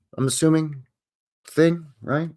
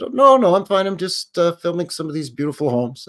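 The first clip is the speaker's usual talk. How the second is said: high, loud, eager to reassure; smooth over a suspicious neighbour cheerfully; mock breezy no-no-I'm-fine; retold polite reassurance; 6 semitones higher, 5 dB louder than usual.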